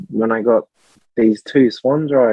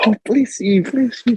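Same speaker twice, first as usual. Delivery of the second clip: blessing church lady; 10 semitones higher than usual. Retold or imitated.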